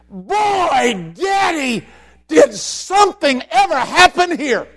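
put-on high, loud amazed child's cry; boy's amazed cry. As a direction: call out in wonder like a little boy. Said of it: "he turns to his daddy and he says".

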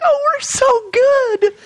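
high, drawn-out mock gushing; fake cheer. Churchgoer hiding a horrible week, mock-cheerful. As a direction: gush it slowly and high, overdoing it. Churchgoer faking being fine.

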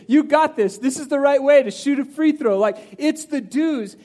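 ordinary talk

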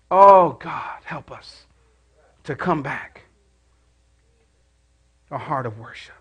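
soft, hushed prayer; prayerful. Acted. Pastor turns to prayer, hushed. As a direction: pray it quietly to God.

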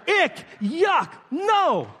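high grossed-out yell; disgusted. Retold or imitated. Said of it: "I'm just looking and going".

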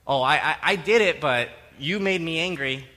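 loud, stammered excuse turning into accusation; defensive blame shifting. Acted. Sinner shifting blame onto the other person, defensive. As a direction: stumble loudly into the excuse. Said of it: "That's blame shifting".

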